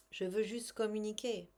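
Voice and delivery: ordinary delivery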